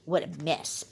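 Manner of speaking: usual speaking voice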